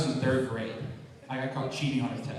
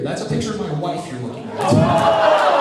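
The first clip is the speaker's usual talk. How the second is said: mock outrage; 9 semitones higher, 10 dB louder, slower than usual. Psychiatrist outraged at the kid.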